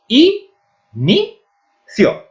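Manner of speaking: I, ni, cio as separate high beats; syllable by syllable. Shown as a demonstration. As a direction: pause after each of I, ni and cio. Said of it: "formada por tres sílabas".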